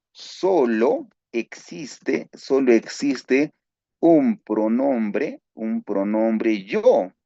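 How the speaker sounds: slow emphatic teaching. Real, not acted. Teacher stressing there's one yo.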